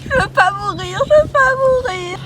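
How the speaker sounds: high sobbing pleas; rising tearful wails; crying in terror. Acted. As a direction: sob that you don't want to die. Captive cries in terror.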